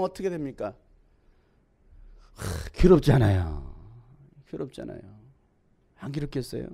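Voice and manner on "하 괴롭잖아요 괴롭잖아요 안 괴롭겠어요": low sighed anguish, dying away softly. Acted. Preacher to congregation on hurtful taunts, low pained sigh. Empathizing with the suffering of the mocked.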